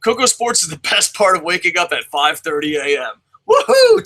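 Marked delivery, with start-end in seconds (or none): 3.5-4.0 loud, high whoop of joy; thrilled woohoo; excited whoop. Real, not acted. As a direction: cheer it out. Cheering a listener's compliment.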